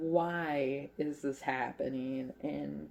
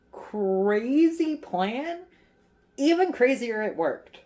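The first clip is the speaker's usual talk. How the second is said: high, loud, drawn-out marvelling; incredulous build-up; amazed emphasis; 8 semitones higher, 6 dB louder, slower than usual. Real, not acted. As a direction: stretch it out and pitch it up. Marvels at the plan working.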